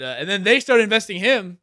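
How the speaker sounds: normal talking voice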